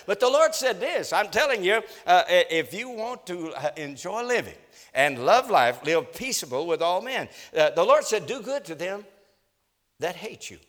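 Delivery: high, loud preacher's exhortation; preacher urging the congregation to peace, fervently; fervent preaching. Acted. Preaching builds.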